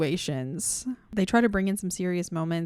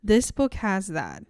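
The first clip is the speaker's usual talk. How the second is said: ordinary talk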